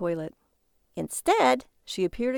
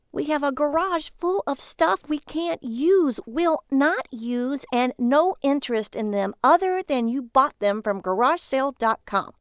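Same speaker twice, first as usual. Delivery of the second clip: scolding wife; 5 semitones higher, 5 dB louder than usual. Acted. Wife warns Barry about divorce, rising to loud. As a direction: lay down the law, then warn him.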